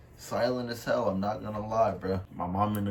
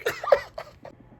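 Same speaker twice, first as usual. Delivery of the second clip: high mock cackle. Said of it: "the Kai Cenat clip".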